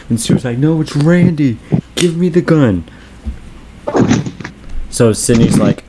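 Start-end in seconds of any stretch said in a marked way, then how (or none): 0.6-2.8 high, insistent acted plea; imitating a desperate suspect; mock urgent plea. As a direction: plead as an accused movie character. Acting rival suspect pleading.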